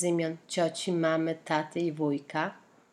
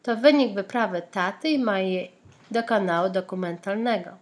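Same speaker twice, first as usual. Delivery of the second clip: ordinary delivery, nothing marked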